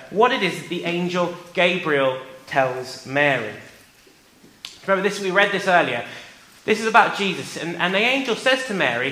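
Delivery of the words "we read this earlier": ordinary talk, nothing marked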